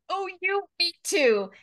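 high, loud, giddy squeal of recognition; excited. Retold or imitated. Friends thrilled to find they feel alike, giddy and excited. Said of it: "We're just so excited, just like".

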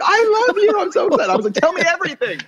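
imitating his overexcited younger self meeting a hero; gushing fan. Retelling meeting his idol, gushing.

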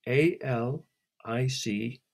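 slow letter-by-letter A L I C; spelling out letters. A narrator's reading. Alice spells her name slowly to Celia. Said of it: "spell it out".

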